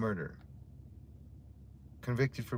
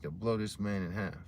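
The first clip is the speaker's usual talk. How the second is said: usual speaking voice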